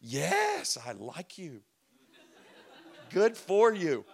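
pleased, laughing aside; secret pride. Retold or imitated. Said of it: "I secretly would go".

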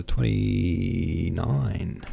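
very slow, stretched number; drawn-out searching. Real, not acted. Reader looking up the next chapter, drawn-out searching. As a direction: stretch it out while hunting for it.

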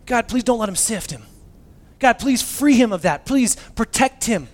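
fervent pleading prayer. Retold or imitated. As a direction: plead with God to spare him. Acted fervent prayer for friend.

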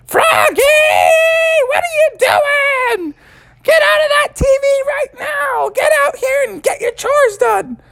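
very high yelling mother; high scolding mother shout; mom yelling. Acted. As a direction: shout him out of the TV. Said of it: "she said".

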